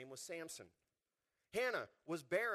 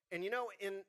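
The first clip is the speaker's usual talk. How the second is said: ordinary talk, nothing marked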